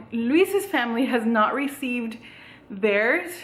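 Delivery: ordinary talk, nothing marked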